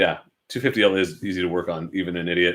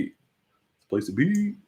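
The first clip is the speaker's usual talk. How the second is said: mock voice; 8 semitones higher, 5 dB quieter, much slower than usual. Repeating phrase in put-on voice.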